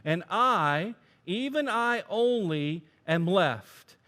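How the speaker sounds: loud, high, aggrieved prophet; complaining Elijah. Acted. Preacher voicing Elijah complaining to God, loud and high. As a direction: pour out your complaint to God loudly. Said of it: "He said".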